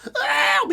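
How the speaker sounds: short, very high shriek. Retold or imitated. Trying to hit the singer's note, screamed high. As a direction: yelp out a metal scream. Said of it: "the note that he screams at".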